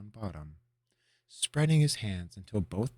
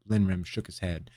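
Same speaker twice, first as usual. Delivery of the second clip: usual speaking voice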